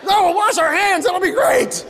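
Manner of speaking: high, hammed-up enthusiasm; mock excitement. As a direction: sell it to a buddy like big news. Mock excited invitation to friend.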